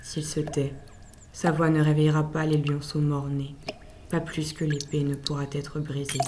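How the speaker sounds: soft, low murmur; hushed. Acted. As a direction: drop to a hush. Narrator drops to hushed voice.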